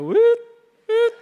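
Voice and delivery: high, loud "woot" noise; imitated seat-button noise. Chief showing how the seat button sounds, high mimicked whirr. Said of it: "they have the button. They go".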